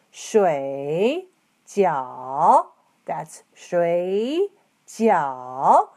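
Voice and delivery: 水 and 饺 dip down and rise, then 水饺 drawn out slowly; 水饺 with slow, dipping third tones; 水饺 spoken very slowly, each syllable down and up. Shown as a demonstration. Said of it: "let's try again".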